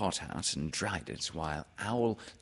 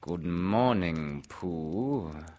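slow, drawn-out storybook greeting; unhurried, stately character voice; Owl; much slower than usual. Acted. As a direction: stretch the greeting out like a ponderous bird. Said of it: "said Owl".

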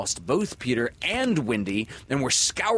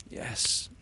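faintly. Acted. Wounded Peter answering Windy faintly. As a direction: let it slip out weakly. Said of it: "he answered faintly".

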